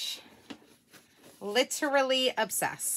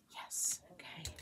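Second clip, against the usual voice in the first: whisper; 6 semitones lower, 14 dB quieter, slower than usual. Real, not acted. Tense moment peeling tray.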